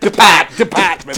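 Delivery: "department" punched out, Boston vowels; spat-out Boston accent. Shown as a demonstration. Practising for co-host, "department" in Boston accent. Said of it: "You just gotta proper spit out that department bit".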